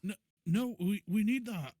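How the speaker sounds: high, loud, stammered protest; alarmed protest. Acted. Companion sees the scroll being thrown, alarmed.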